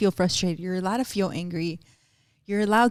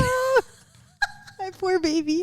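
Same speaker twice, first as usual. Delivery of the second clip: high-pitched pitying coo; cooing sympathy; 12 semitones higher, slower than usual. Real, not acted. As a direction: coo it like pitying a hurt pet. Cooing over the dog's mishap.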